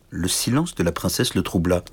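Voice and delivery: ordinary delivery, nothing marked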